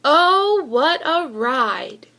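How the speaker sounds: plain reading voice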